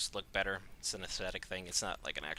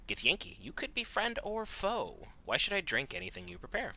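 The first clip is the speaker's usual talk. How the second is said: suspicious Githyanki; 10 semitones higher than usual. Pretend. Player reads dialogue option.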